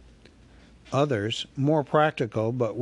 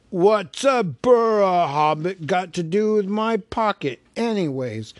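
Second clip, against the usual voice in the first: high, loud troll voice, pressing; suspicious troll; 7 semitones higher, 5 dB louder than usual. Acted. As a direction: William the troll questions the hobbit. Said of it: "said William".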